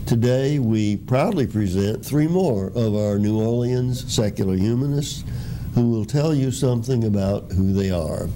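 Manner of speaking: ordinary talk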